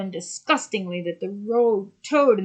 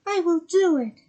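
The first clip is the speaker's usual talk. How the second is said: put-on a higher young girl voice; young mermaid voice; 11 semitones higher, 5 dB louder than usual. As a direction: speak up as the little mermaid. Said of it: "said the little mermaid".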